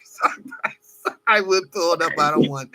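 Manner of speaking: ordinary talk, nothing marked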